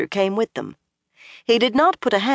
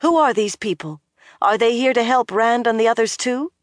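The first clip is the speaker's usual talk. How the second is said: urgent; 7 semitones higher than usual. A narrator's reading. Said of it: "she said urgently".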